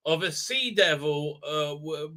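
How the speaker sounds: normal talking voice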